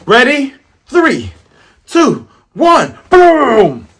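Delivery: high, loud firing countdown; acted countdown shout. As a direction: shout the countdown, exploding on boom. Acts out Buggy's cannon countdown.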